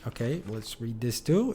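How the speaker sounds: ordinary delivery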